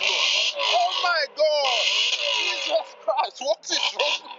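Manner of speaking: high, slow stunned outburst; amazed exclaim. Real, not acted. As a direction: gasp it out in disbelief. Reacting to loud exhaust.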